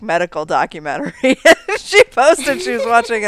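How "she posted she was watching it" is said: loud, high, through laughter; amused, laugh-filled telling. Real, not acted. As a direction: tell it while cracking up. Laughing while telling sister anecdote.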